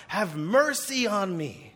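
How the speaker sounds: slow, high mock scream for mercy; crying out. Blind beggar keeps begging Jesus despite the crowd, crying out. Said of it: "screaming out".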